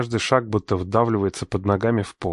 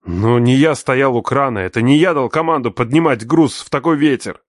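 heated defense; 4 semitones higher, 4 dB louder than usual. Acted. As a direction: push back hard at father. Son argues back heatedly.

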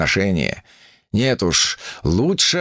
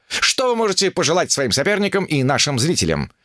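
higher, eager reporter voice; chirpy reporter, higher pitch; 6 semitones higher than usual. A narrator's reading. Reporter fires questions.

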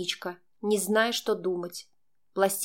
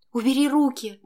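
higher, panicked angry order; trembling demand; 5 semitones higher, 4 dB louder than usual. A narrator's reading. Girl struggling against Max's hands, trembling demand. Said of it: "задыхаясь, процедила я дрожащим голосом".

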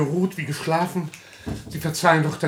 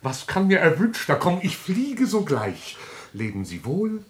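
ordinary delivery

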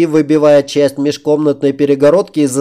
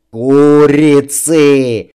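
slow, drawn-out triumphant bellow; triumphant roar; 2 semitones higher, 6 dB louder, much slower than usual. A narrator's reading. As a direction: roar it out in victory. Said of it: "победоносно воздел к потолку руки король".

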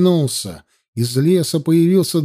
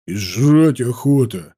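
low, slow grumble; lightly voiced lower soldier, drawn out; hungry guard; 5 semitones lower than usual. A narrator's reading. Third guard complains of hunger to comrades, grumbling.

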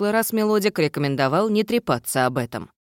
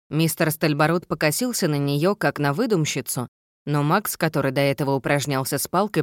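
ordinary delivery, nothing marked

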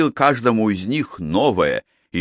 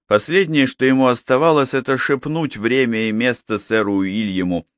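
plain reading voice

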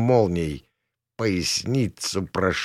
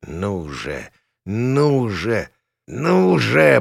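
straining croak; much slower than usual. A narrator's reading. Pilot hauling the nose up, straining croak. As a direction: strain harder with each repeat. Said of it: "прохрипел я".

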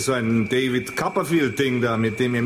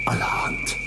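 breathless whisper of astonishment; awed, voiceless gasp of a word; amazed whisper. Acted. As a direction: whisper it, stunned by what he just saw. Steel bar vanishes before him.

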